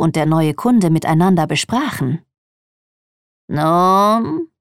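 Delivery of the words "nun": creaky squeak like a rusty hinge; a pushy customer presses the shopkeeper, squeaking like a rusty hinge; squeaky hinge voice. A narrator's reading. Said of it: "die quietschte wie ein rostiges Scharnier".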